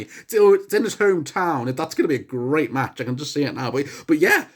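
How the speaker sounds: ordinary delivery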